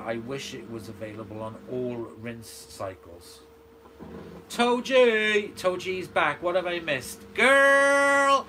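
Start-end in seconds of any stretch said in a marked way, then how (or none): 4.5-8.5 high, loud hammed-up welcome; excited call-out. Real, not acted. Host hails a returning viewer, excited call-out. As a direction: shout it out, stretching the last word high.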